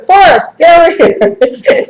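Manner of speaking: high, loud mock call to a butterfly. Glenda calls the butterfly over, high and loud. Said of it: "She's going".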